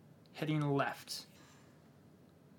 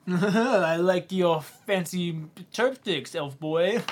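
mocking; 3 semitones higher, 7 dB louder, much slower than usual. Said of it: "I was trying to do a spin off that".

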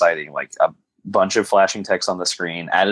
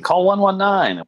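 mock urgent shout; 13 semitones higher, 3 dB louder, slower than usual. Voicing the movie's line.

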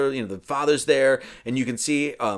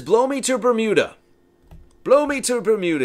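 high, loud mock exclamation; exasperated outburst; 9 semitones higher, 6 dB louder than usual. Merlin storming off from Arthur, high acted outburst. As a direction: cry it out like a fed-up wizard.

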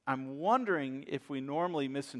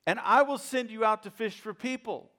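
hollering out; 6 semitones higher, 5 dB louder than usual. Retold or imitated. Preacher voicing Jesus calling Peter and Andrew, hollered out. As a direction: call out to fishermen across the water. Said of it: "he hollered out".

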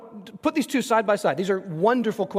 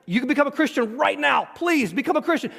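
ordinary talk

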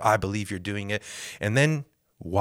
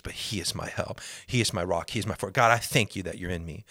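usual speaking voice

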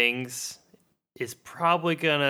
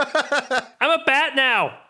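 put-on high bat-kid voice; 12 semitones higher, 4 dB louder, slower than usual. Playing kid turned bat.

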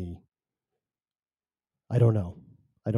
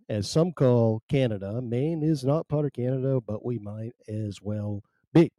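ordinary talk, nothing marked

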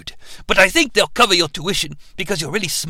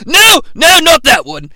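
sudden shout of no, higher; mock correction; 7 semitones higher, 6 dB louder than usual. Real, not acted. Correcting the audience, shouted no. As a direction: blurt out a high loud no.

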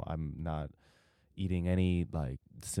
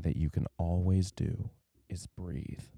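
whisper. Shown as a demonstration. Whispered advice to breathe.

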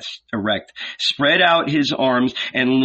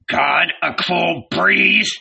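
soft growl; 7 semitones higher, slower than usual. A narrator's reading. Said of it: "he growled softly, infuriated".

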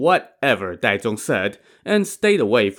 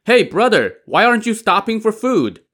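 high, raised call from behind; shouting; 11 semitones higher, 4 dB louder than usual. A narrator's reading. Daizong calls from behind to his hurrying brother, shouting.

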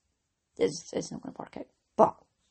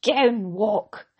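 toys out the pram; 7 dB louder than usual. Retold or imitated. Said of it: "I threw my toys out the pram".

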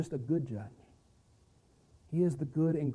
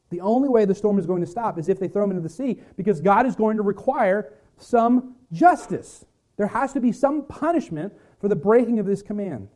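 preaching with force; 5 semitones higher, 6 dB louder than usual. Acted. Preaching about God's justice.

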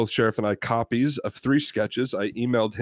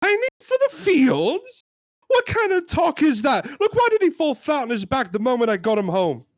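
very high, incredulous British outrage; hammed-up British customer, aghast; British accent; 14 semitones higher, 4 dB louder than usual. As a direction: splutter loudly in a put-on British accent. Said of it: "We're gonna try our British accents".

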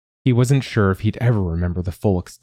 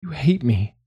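high, wounded voice; hurt accusation; 7 semitones higher than usual. Acted. Dazed Pinyat to Manya, hurt. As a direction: say it like a wounded child.

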